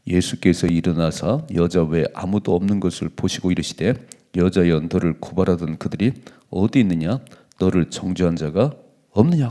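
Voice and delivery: loud group Bible reading; scripture reading. Acted. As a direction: read the verse out together. Congregation reads scripture aloud. (성경 봉독)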